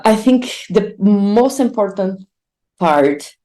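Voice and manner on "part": normal talking voice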